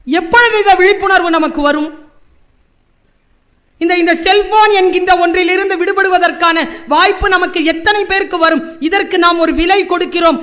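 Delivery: loud, high impassioned speech; full-force oratory. Real, not acted. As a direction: preach it to the hall. Orator raises voice. (உணர்ச்சிமிக்க மேடைப் பேச்சு)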